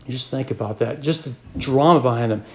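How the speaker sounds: normal talking voice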